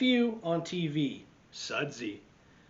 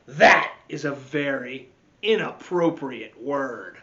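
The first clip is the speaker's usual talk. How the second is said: louder scolding voice; stern supervisor; 7 dB louder than usual. Acted. Recess supervisor scolds Michael, loud and stern. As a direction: lay down the rule firmly. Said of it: "said the recess supervisor".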